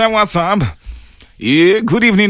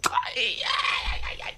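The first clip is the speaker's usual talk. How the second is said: hammed-up high crying shout, slow, fading to a breathy whimper; comic despair, much higher and slow; mock wail; 11 semitones higher, 7 dB quieter, much slower than usual. Mock despair at "future wife.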